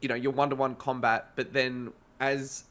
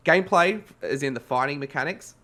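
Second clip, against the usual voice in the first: ordinary delivery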